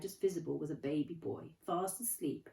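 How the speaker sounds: plain reading voice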